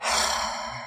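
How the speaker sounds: a long unvoiced sigh; long weary sigh. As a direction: let all the defeat out in one sigh. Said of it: "let out a long sigh".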